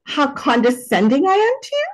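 hammed-up superior air, pitch swooping upward; sing-song mock haughtiness, climbing high at the end; playfully condescending. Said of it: "really embodied that condescending part of me".

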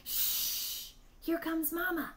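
hushed hiss with a long shh. A narrator's reading. Baby bird warns siblings that Mama is coming, hissed. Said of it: "hissed Pip".

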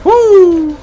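high, loud triumphant whoop. Real, not acted. Player escaping the hand, whooping. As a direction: let out a long piercing woo.

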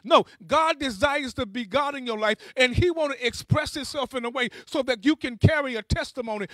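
normal talking voice

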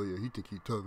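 low, indistinct grumble. Retold or imitated. Class clown talks trash about the teller, on the low. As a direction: imitate trash talk kept under the breath. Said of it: "just talking shit on the low".

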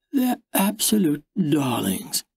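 a hint of schmoozing at dinner with the in-laws; salesy charm voice. A narrator's reading. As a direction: sweet-talk the buyers like a shop clerk. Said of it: "she had that voice".

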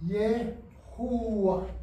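very slow, syllable by syllable Ye-ho-vah; Hebrew Yehovah. Shown as a demonstration. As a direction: draw out "Yehovah" carefully with a Y sound.

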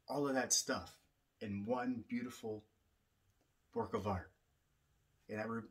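low, soft, unhurried spiritual talk; soft reflective teaching. Acted. Drum teacher softly reflecting to listeners on the drum. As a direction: speak gently, like sharing a blessing.

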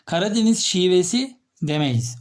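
ordinary talk, nothing marked

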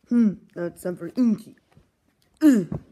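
usual speaking voice

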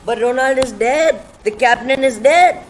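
loud, high alarmed yelling; panicked shouting. Real, not acted. Game character dies, panic yelling.

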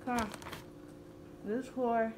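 garbled through marshmallows; mouth full. Real, not acted. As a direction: talk with stuffed cheeks.

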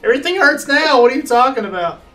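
ordinary delivery

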